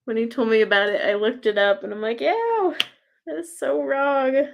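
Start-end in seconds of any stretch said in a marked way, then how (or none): 2.2-4.5 high-pitched "ew" of mock disgust; retold disgust. Reacting to looking up a fur pillow, disgusted. Retells recoiling at a product.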